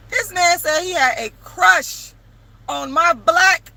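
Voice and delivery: can't believe what she's telling; real amazed shock; shocked disbelief. Real, not acted. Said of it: "in shock".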